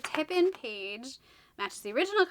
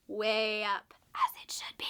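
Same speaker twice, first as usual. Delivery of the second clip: whispered aside; 3 semitones lower, slower than usual. Real, not acted. Confiding aside in the summary.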